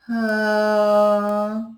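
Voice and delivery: high, light ha from a tightened throat; resonant ha. Shown as a demonstration. Coach showing the ha sound. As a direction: breathe it in and release a bright ha. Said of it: "and go".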